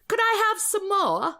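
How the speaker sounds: high, slow English orphan plea; Oliver Twist. Acted. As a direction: beg in a hammed-up English accent. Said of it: "I channel Oliver Twist".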